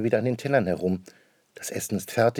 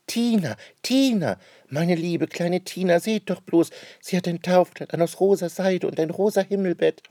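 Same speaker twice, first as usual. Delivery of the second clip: put-on high, louder girlish rapture; delighted child; 10 semitones higher, 4 dB louder than usual. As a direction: gush over the new doll. Anne-Rose jubelt über Puppe. (Anne-Rose jubelt über ihre Puppe)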